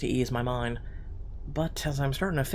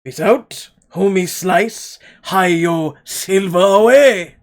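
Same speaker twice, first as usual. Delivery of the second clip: hammy cartoon caller, gleeful; wacky cartoon voice; 8 dB louder, slower than usual. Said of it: "like a wacky cartoon character".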